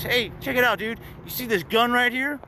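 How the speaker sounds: high, taunting cop voice; mock the cop showing off his gun; threatening cop. Cop flashing a gun at him, high taunt.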